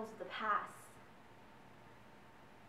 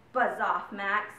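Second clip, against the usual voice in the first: usual speaking voice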